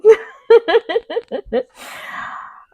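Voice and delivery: long laugh rising very high; giggling fit. Real, not acted. Laughing at circling reading.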